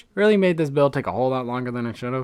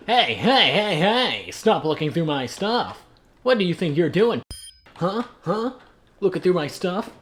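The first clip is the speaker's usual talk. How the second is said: indignant calling out, higher pitched; mock angry scolding; 5 semitones higher than usual. Player catching someone looking through his stuff, mock angry scolding. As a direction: challenge a snooper with hammed-up outrage.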